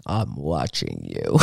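very low, slow creepy voice. Pretend. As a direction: drop low and drag it out like a horror villain. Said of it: "I gotta find a creepy voice".

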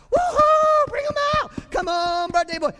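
very high, loud whooping cheer; mock birthday cheer. Preacher mocking loud cheering at a birthday party, very high. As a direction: hype up the birthday boy like a stadium crowd. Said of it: "We don't cheer at a birthday party".

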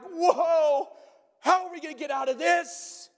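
high-pitched, hammed-up dismay; mock meltdown. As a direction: melt down in exaggerated panic. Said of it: "you just melt".

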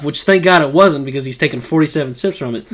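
ordinary talk, nothing marked

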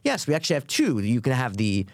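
imitated cheerful cashier, lilting upward. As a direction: list the options like an eager server. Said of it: "Very, very cheerful".

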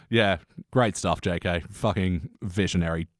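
mock praise for J K Rowling; sarcastic praise. Real, not acted. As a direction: praise her while meaning the opposite.

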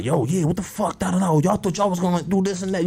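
lightly acted, high-pitched challenge; mock angry confrontation. Imitating neighbourhood people confronting the group, mock anger.

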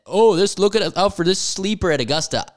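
loud, high tipster voice; hyped pundit. Retold or imitated. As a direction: sell the sleeper pick with hype. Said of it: "short list of guys that".

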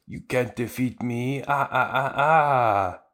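hammed-up octopus fishman gloating; cartoon villain calling up his sea monster; Hachan the octopus. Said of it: "Hachan, the octopus guy, who's like".

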